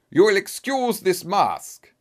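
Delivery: put-on emphatic, formal German accent; strong German accent. A narrator's reading. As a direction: lay down terms in a German accent. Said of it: "he asked in a strong German accent".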